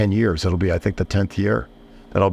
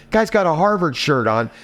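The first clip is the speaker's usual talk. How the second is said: normal talking voice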